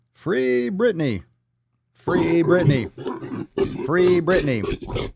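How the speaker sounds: long drawn-out, high rallying call; hammed-up slogan shout, pitched high; mock protest cry. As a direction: stretch out "free" like a slow chant. Chanting a protest slogan on stream.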